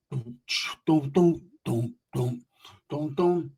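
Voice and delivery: thump like distant car music; imitated bass beat. Stranded on a rock, hearing far-off music, imitated bass thumps. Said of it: "I hear some music bumping".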